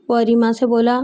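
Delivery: ordinary delivery, nothing marked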